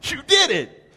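ordinary talk

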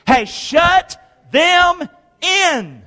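slow, high shout. Acted. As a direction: hammer it home.

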